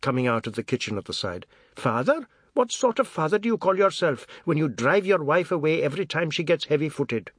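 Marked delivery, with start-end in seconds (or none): 1.8-7.3 scornful scolding. A narrator's reading. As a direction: scold him with contempt. Grandmother scolds the father.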